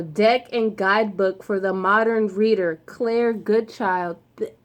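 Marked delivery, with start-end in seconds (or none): none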